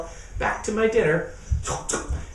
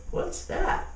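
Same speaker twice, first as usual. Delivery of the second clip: plain reading voice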